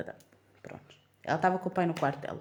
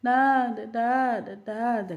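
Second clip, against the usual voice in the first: higher, louder whimpered Dada; crying toddler; 5 semitones higher, 5 dB louder, much slower than usual. Retold or imitated. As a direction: mimic a toddler crying for daddy. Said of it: "a chorar".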